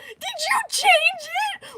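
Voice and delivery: very high, slow, playful squeak; high teasing. Real, not acted. Streamer quizzing a viewer about the tag, high and slow. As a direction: ask it in a tiny high mock voice.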